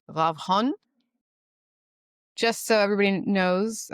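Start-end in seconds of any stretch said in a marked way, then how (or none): 0.1-0.7 light Israeli accent on the surname. Shown as a demonstration. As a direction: say the name with a careful Israeli accent. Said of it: "I'm gonna try to do this with my best Israeli accent".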